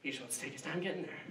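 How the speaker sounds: soft, regretful muttering to oneself; second-guess yourself after honking; hushed remorse, quick at the end; guilty murmur. Retold or imitated. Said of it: "I'd be".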